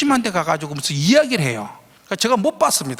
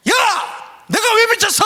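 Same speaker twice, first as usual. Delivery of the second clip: high, loud furious protest; angry shouting; 13 semitones higher, 3 dB louder than usual. Retold or imitated. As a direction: yell back at the umpire. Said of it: "하니까 얘가".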